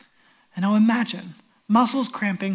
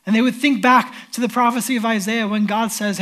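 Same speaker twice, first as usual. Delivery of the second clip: ordinary talk, nothing marked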